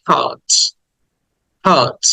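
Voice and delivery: "hot" with a long aa, silent r; drawn-out vowel. Shown as a demonstration. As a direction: stretch the vowel in "hot", haat. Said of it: "এই জন্য বলতে হবে".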